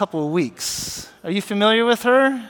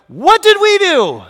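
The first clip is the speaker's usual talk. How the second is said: loud, high-pitched panicked protest; confused, alarmed outcry; 12 semitones higher, 9 dB louder than usual. Acted. Daniel and friends facing a sudden death sentence, shouting in alarm. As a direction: cry out in bewildered alarm. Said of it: "how confused and, and how concerned they must be".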